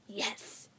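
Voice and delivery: whispered hiss. A narrator's reading. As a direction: hiss the word out. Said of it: "he hissed".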